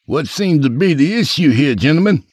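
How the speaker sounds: high-pitched official guard voice; security guard. Acted. Guard pulls up to golfers, high-pitched official voice. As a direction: play the guard stepping in.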